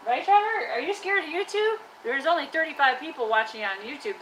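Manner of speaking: high-pitched, playful questioning; teasing coaxing. Pretend. Teasing the trash can as shy.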